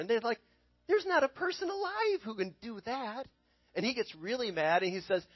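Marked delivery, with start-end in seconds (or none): 0.9-3.3 high, strained protest from the astrologers; frightened court astrologers crying out; panicked astrologers. Retold or imitated. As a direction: plead it's impossible, voice jumping up. Astrologers protest the impossible demand.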